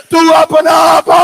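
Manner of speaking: a breaking lament shout; wailing cry. Acted. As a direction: cry out to the father, begging.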